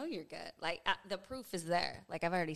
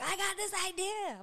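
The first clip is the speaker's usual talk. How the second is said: much higher mimicking voice; mock wife. Husband mimicking his wife's pitch, high mock voice. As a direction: squeak it like an eager kid. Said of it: "So when you're like".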